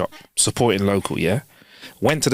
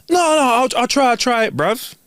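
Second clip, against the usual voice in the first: mimicking barista; 12 semitones higher than usual. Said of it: "he went".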